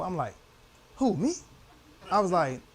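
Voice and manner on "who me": acted disbelief. Own reaction to God, retold.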